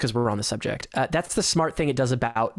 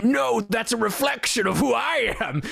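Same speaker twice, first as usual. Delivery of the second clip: lightly voiced Batman, pitched higher, mocking; 9 semitones higher than usual. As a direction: parody the hero's self-serious line. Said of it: "He's like".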